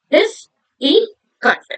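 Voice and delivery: 'difficult' very slow, a gap after each syllable. Shown as a demonstration. Teacher counting syllables for the class, syllable by syllable. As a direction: split 'difficult' into dif, fi, cult. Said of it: "So three syllables".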